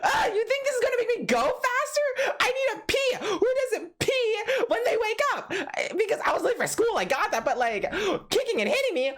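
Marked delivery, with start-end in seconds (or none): 0.0-5.5 high, tearful mock whine; a kid sobbing out a protest at his dad; crying complaint. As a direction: whine through tears as a kid. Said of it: "I was crying".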